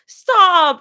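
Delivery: high, loud protest; embarrassed yell. Acted. Embarrassed girl yelling at friends who call her cute. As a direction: cut off their teasing, loud and high.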